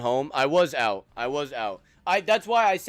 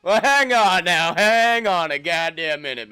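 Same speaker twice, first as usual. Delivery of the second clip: mock indignation; 3 semitones higher, 7 dB louder than usual.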